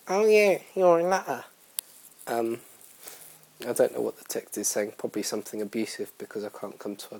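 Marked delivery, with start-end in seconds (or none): 0.1-1.4 high-pitched mimicry of a friend; high, teasing impression of a mate; imitated friend. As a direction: put on a higher voice to mock-quote a friend. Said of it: "And she's like".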